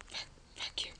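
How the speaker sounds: cursing under the breath; whispered curse. Real, not acted. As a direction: swear quietly at the screen. Swearing at the game.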